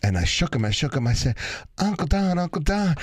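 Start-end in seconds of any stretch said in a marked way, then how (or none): 1.8-2.9 put-on high-pitched child waking his uncle; urgent small-boy call, pitched up high; little boy calling. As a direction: play a kid rousing a sleeping uncle. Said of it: "I was just a little boy".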